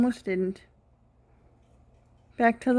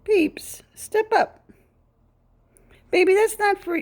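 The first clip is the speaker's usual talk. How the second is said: high sing-song coaxing; 8 semitones higher, slower than usual. Real, not acted.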